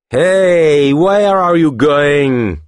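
high, loud, drawn-out taunt in a different voice; taunting thug. A narrator's reading. The tallest thug taunts the pair blocking their way, mock-friendly threat. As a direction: lean into a menacing catcall.

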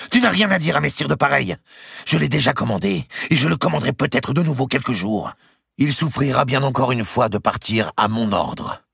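high, heated, imperious outburst; crying out. A narrator's reading. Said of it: "cria Marguerite".

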